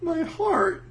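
high, hammed-up anguish; mock heartbroken. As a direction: squeak it like you've been stabbed. Mock wounded reaction.

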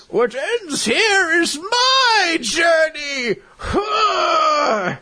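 slow, high-pitched dramatic shout; hammed-up shout, drawing the words out; heroic battle cry. Makarov declaring his last stand, hammed-up defiant battle cry.

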